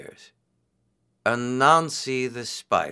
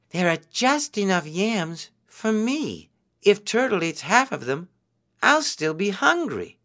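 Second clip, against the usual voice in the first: Anansi voice; 7 semitones higher than usual. A narrator's reading. Anansi's greedy thought voiced.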